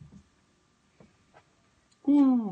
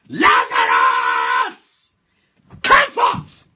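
shouted command; 9 semitones lower, 11 dB louder, much slower than usual. Shown as a demonstration. Preacher demonstrating how to call the dead out, huge shout. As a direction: roar it out with full faith. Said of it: "You are going to pray that prayer".

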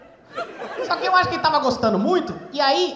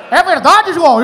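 host voice; 2 semitones higher, 9 dB louder, slower than usual. Retold or imitated. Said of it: "host asks son".

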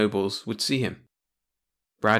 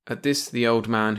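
usual speaking voice

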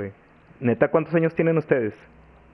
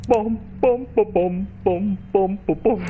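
pom pom sound effect; 7 semitones higher, slower than usual. Pretend. Mimicking creepy footsteps or sound.

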